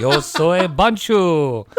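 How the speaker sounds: drawn-out, playful Spanish introduction; hammed-up Spanish, slowly; showy Spanish. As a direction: stretch it out in mock-grand Spanish. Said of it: "say a word in Spanish".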